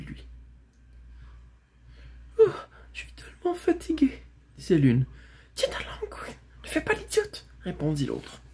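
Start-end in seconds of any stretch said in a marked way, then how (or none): 2.4-4.2 high, plaintive, tired voice; weary mare. Acted. Tired mare complaining to her rider, high and plaintive. 5.5-7.5 high, half-whispered reprimand; hushed scolding. Acted. Girl silencing her talking horse, hushed and cross.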